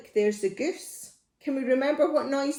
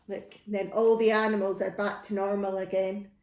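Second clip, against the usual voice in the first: ordinary delivery, nothing marked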